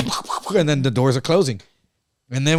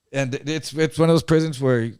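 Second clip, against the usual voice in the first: ordinary talk, nothing marked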